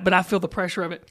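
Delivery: usual speaking voice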